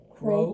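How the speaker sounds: loud, short toad croak; storybook toad noise. A narrator's reading. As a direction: imitate a toad's croak. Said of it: "Toad said".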